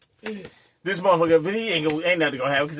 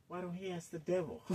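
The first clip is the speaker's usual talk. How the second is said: quiet aside; 18 dB quieter than usual. Retold or imitated. Whispered aside to girlfriend.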